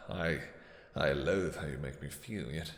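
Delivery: ordinary talk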